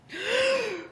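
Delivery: high choking gasp; sharp gasp. Acted. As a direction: gasp as if out of air. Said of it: "Muskrat gasped".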